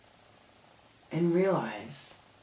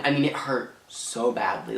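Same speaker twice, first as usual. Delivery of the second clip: ordinary talk, nothing marked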